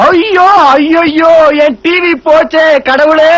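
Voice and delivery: long, drawn-out comic wail of grief; mock-tragic sobbing lament; loud wailing. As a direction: cry out to the heavens, hammed-up. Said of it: "ஏன் இப்படி கத்துற".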